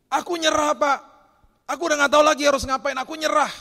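very high, shouted imitation of giving up; desperate cry. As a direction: cry out at breaking point, acted full force. Acting out people's desperate surrender.

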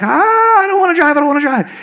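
high-pitched wail, imitating a panicked kid; mock flustered daughter wailing; flustered teen. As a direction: whine it high, all worked up. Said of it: "she got all flustered".

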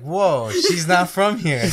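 high, loud, slow drawn-out amazement; retold wide-eyed wonder at a stranger's voice; amazed whoa. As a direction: stretch it out like hearing a surprising accent. Retelling surprise at her accent.

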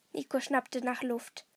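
usual speaking voice

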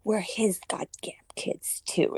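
put-on soft, slow, breathy tiny child; imitating a tiny child's sweet lisp; little girl voice. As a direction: whisper it like a small lisping girl. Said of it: "With the little voice".

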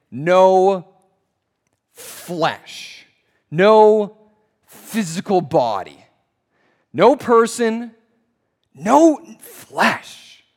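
loud, weighty "No" with a pause before "flesh"; emphatic stress. Shown as a demonstration. Preacher showing the original wording's force, emphatic and loud. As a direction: punch each "no" loudly and separate the phrases. Demonstrates emphatic translations of Paul.

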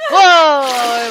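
loud startled whoa; startled shout. Real, not acted. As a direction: jump back with a shout. Jump scare in horror game.

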